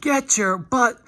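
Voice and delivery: imitating a player barking orders, pitched high; mock yelling. Linebacker yelling at James to get over, mock yell. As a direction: snap at the teammate to get in position. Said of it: "he's yelling at James".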